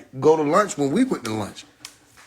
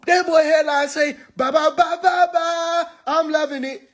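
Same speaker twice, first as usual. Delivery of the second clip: high sing-song mock; mock jingle taunt; 12 semitones higher than usual. Roaster mocking his shoes, high sing-song. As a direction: chant it like the ad jingle.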